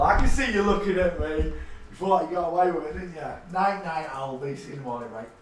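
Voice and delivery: usual speaking voice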